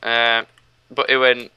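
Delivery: ordinary talk, nothing marked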